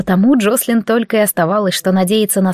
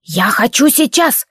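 insistent bandit voice; 2 semitones higher, slower than usual. Acted.

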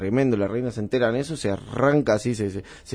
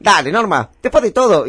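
loud, high old-movie actor imitation; hammed-up vintage cinema delivery; old Argentine film tone; 6 semitones higher, 7 dB louder than usual. Shown as a demonstration. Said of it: "¿Viste ese tono?".

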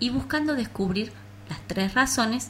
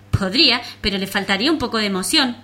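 loud, fast playful reply; thrill-seeking ladybug; 2 semitones higher, 7 dB louder, faster than usual. Acted. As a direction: rattle it off for fun.

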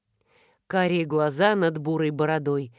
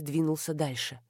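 plain reading voice

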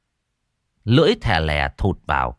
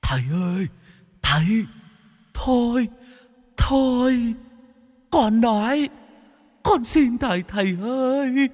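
very high, eerie whining plea; whimpering plea. A narrator's reading. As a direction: beg the master like a sobbing spirit. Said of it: "Nó mếu máo".

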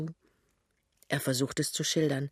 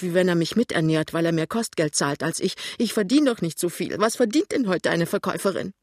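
louder, climbing into an indignant outburst; aggrieved heroine; 6 dB louder, faster than usual. Acted. Lämmchen complains to her fiancé about her family, indignant and louder. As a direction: pour out the resentment, voice rising.

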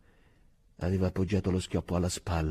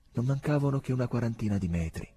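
usual speaking voice

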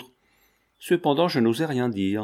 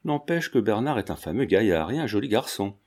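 usual speaking voice

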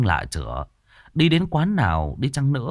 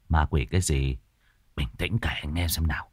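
husband voice; 7 semitones lower, 5 dB quieter than usual. A narrator's reading. Husband answers wife, lower.

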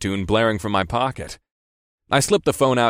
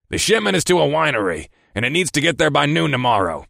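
lightly voiced gruff boss, pitched up; 6 semitones higher than usual. Boss orders a delivery by phone, mildly gruff.

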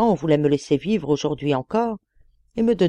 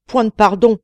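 booming loud threat; terrible genie voice; 5 semitones higher, 9 dB louder than usual. A narrator's reading. Old giant genie threatening the merchant, loud terrible voice. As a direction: roar it down at him, sword raised.

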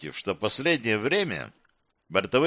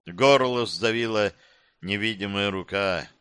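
ordinary delivery, nothing marked

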